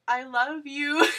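high, loud, laughing and teary; giddy blurt. Real, not acted. Fan walking backwards from her idol, blurting out love. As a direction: blurt it out overwhelmed. Blurted while backing away.

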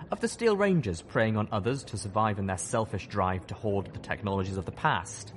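ordinary delivery

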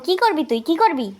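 ordinary delivery

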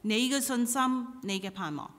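usual speaking voice

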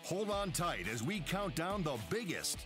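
usual speaking voice

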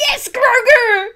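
laughing, teary cheer; excited. Real, not acted. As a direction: cheer with joy. Cheering for Grogu.

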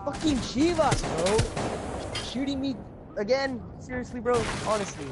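angry. Real, not acted. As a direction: call out the teammate who shot you. Teammate shot him, complaining.

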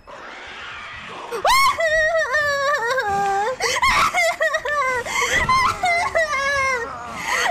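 high hysterical laugh with a shout; panicked shrieking laugh. Real, not acted. Boss music surprise, panicked laugh.

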